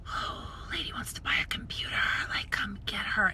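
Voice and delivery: fast whispered plea; telepathic whisper. Retold or imitated. Vlogger sending telepathic messages to staff, whispering. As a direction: send the thought in a quick whisper. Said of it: "I'm just all".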